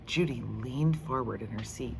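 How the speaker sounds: ordinary delivery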